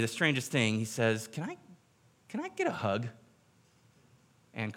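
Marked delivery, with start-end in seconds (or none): 1.3-3.1 much higher, soft, hesitant voice; timid childlike plea. Retold or imitated. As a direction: ask like a small shy child. Gunman timidly asks for hug.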